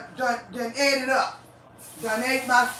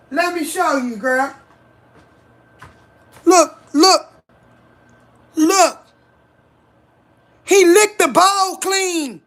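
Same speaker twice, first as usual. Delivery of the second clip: louder and louder, higher angry yelling; furious rant; 4 semitones higher, 9 dB louder than usual. Real, not acted. Showing a friend the licked-clean bowl, building angry yell. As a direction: build into a loud, outraged yell.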